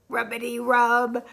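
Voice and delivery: plain reading voice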